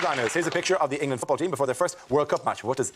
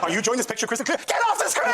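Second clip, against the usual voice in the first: mock advert outburst; 9 semitones higher than usual. Comic acts out imagined telly advert.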